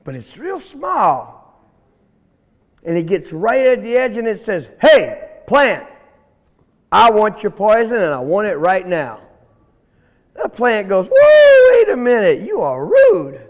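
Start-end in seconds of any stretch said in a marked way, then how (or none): 4.8-9.2 loud, pushy little snake; rude demand. Acted. Young snake ordering the plant to hand over poison, rude and loud. 11.1-13.4 high, loud "whoa", then a scolding; indignant shock. Acted. Plant taken aback by the demanding snake, loud and indignant.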